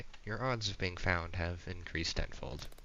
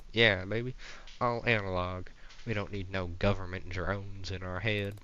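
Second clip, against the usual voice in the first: hammed-up Southern conspiracy rant; Southern accent. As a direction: preach it like a Southern rebel. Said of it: "This guy's Southern now".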